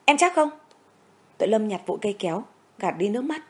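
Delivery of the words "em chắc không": much higher, louder stunned question; can't believe what he hears; stammering in shock. A narrator's reading. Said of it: "cậu lắp bắp". (lắp bắp sửng sốt, giọng cao vọt)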